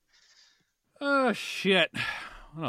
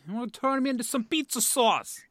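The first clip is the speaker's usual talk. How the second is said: much higher, louder mock Italian accent; 13 semitones higher, 6 dB louder than usual. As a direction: ham up the Italian accent for the pizza joke. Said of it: "Jim with the Italian accent going".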